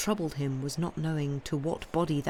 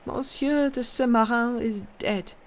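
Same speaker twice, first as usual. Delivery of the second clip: put-on higher old woman's voice, numb and without feeling; numb stupor; 7 semitones higher, 4 dB louder than usual. A narrator's reading. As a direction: say it as if in a daze. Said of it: "without any sign of feeling, in a kind of stupor".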